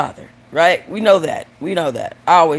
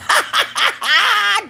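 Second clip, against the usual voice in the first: high cackle. Real, not acted. Joker cracks up at bubbles punchline, high cackle.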